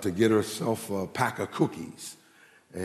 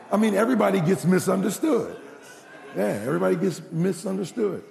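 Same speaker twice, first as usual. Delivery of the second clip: laughing through it; 8 semitones higher, faster than usual. Real, not acted. Preacher laughing through the line.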